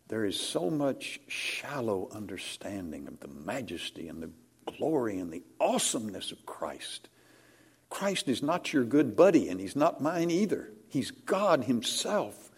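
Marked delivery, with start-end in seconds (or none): 0.1-7.1 much softer, near a whisper; soft grave sorrow over shallow faith; hushed solemn. Acted. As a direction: mourn it quietly.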